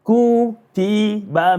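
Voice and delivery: Kutiba with long drawn-out vowels; prolonged syllables. Shown as a demonstration. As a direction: hold each vowel, kuu-tii-baa. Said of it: "may be applicable at the right time".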